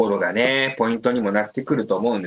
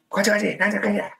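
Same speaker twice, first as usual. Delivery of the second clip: high, rapid-fire imitation of a player calling; mock on-pitch calls; 8 semitones higher, much faster than usual. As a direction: imitate busy team instructions, pitched up and fast. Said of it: "学さんめっちゃ声出しますよね". (高い声で早口に選手の掛け声を真似て)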